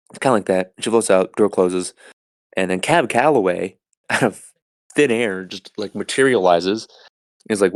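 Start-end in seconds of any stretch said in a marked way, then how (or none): none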